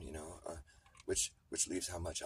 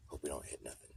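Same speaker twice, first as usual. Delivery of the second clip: usual speaking voice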